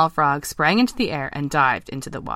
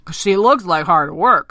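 slow, louder weary frog; sighing; 2 semitones higher, 6 dB louder, slower than usual. Acted. Ken doubting the princess to his friends, sighing. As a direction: draw it out with a sigh. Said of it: "sighed Ken".

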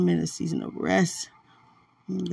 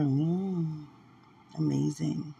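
ordinary talk

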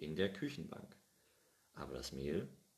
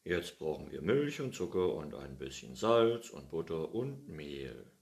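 put-on louder, hearty old farmer voice; happily starting the baking; cheerful old man; 8 dB louder than usual. Pettersson telling the cat they'll start now, hearty. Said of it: "sagte er".

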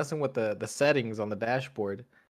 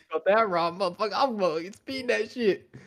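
cocky mock driver; 7 semitones higher, 3 dB louder than usual. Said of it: "mock reckless driver".

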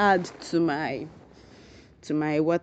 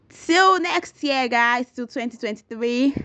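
much higher, slow sing-song goodbye; higher, slower, louder farewell call; excited farewell; 6 semitones higher, 5 dB louder, slower than usual. Real, not acted. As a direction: stretch it out joyfully.

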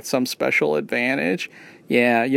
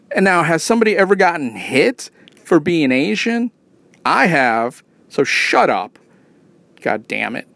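ordinary delivery

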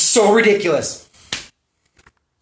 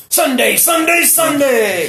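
high, shouted monster truck ad hype; 6 semitones higher, slower than usual. Pretend. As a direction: scream it like a radio truck ad.

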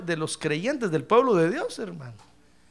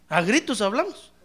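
ordinary talk

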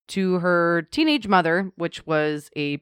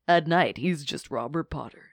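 usual speaking voice

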